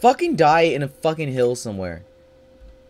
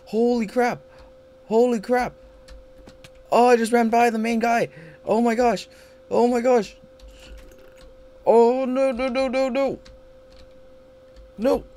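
much higher, loud frantic exclaiming; high excited panic; 12 semitones higher, 3 dB louder than usual. Real, not acted. Gamer panicking after running past the main guy. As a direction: gasp it out after a close call.

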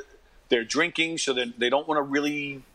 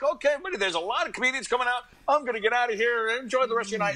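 mock cheery exit; 10 semitones higher, faster than usual. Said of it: "I was just like".

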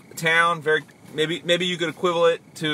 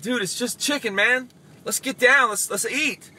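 higher, easygoing mock dude voice; a loose, carefree buddy, pitched up; laid-back friend; 7 semitones higher than usual. Relaxed friend voiced in market scene.

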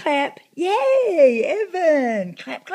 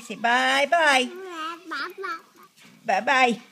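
coaxing singsong; 6 semitones higher, 5 dB louder than usual. Real, not acted. Coaxing baby to wave.

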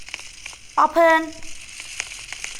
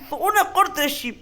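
normal talking voice

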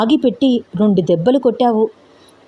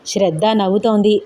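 usual speaking voice

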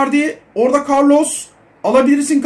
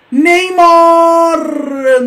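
excited drawn-out shout; 5 semitones higher, 5 dB louder than usual. Real, not acted. Gamer hyping a chance at Neymar on his phone, long excited shout. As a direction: stretch the name out in a long, loud excited shout. Goal chance in live game commentary.

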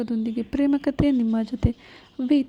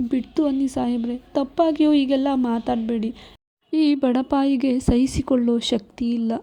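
ordinary delivery

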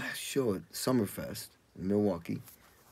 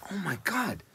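stunned gasp; 9 semitones higher, 4 dB quieter than usual. Retold or imitated. Shocked at news.

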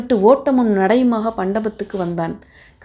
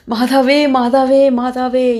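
higher, louder tearful plea; wailing; 5 semitones higher, 4 dB louder, slower than usual. A narrator's reading. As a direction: wail to the Mother, begging. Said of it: "கதற ஆரம்பித்தார்".

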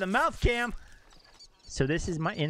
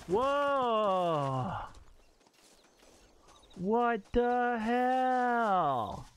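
stunned disbelief; 6 semitones higher, much slower than usual. Real, not acted. Shocked at trashed house.

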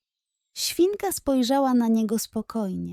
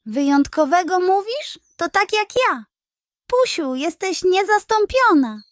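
higher and louder, gleefully exclaiming; delighted exclamation; 9 semitones higher, 5 dB louder than usual. A narrator's reading. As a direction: crow with greedy delight. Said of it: "zawołał".

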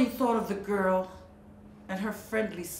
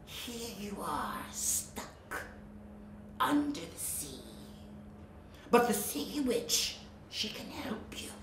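sly snake whisper; 10 dB quieter than usual. Acted. Sea snake luring Melody to the witch, sly whisper. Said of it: "the sly creature insisted".

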